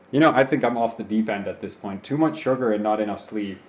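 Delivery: usual speaking voice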